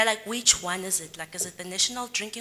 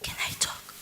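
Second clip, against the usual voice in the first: whisper; 5 dB quieter than usual. Real, not acted. Asking to speak into a mic.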